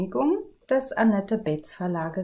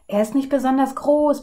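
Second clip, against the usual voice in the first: higher, louder messenger voice; messenger report; 6 semitones higher, 6 dB louder, faster than usual. Acted. Messengers reporting to the king, raised voice. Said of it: "berichten die Boten".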